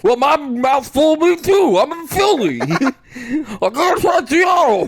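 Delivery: mock Philadelphia accent, shouted; Philly accent. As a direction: shout it like a Philly guy with a mouthful. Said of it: "the Philly accent".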